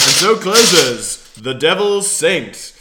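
radio announcer. Acted. Announcer closing of radio play.

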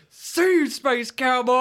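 loud, high cowboy send-off holler; screamed farewell. Acted. Player in character to the launched space cowboy, screaming. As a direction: scream it after a friend flying away. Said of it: "I will fucking scream".

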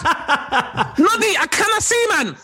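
high-pitched hammed-up Geordie wail; agonised Geordie accent. Geordie teen-soap lad blinded by paintball, mock agony. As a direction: cry out in bad Geordie, blinded and in pain. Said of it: "trying to convey the agony of having paintball paint in".

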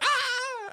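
high laughing yelp; mock karate yell. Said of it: "He's like".

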